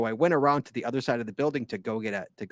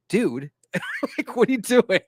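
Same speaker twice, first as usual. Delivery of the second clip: laughing quote; 8 semitones higher than usual. Retold or imitated. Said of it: "he's like".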